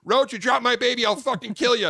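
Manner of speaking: high, very loud mock-furious yell; abrasive threat. Mother warning him not to drop her baby, furious yell. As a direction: bellow a warning like a fierce mother. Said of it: "but she speaks abrasively".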